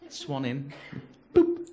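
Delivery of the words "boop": loud, high imitated till beep; scanner beep. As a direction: mimic a piercing checkout blip. Imitates self-checkout scanner beep.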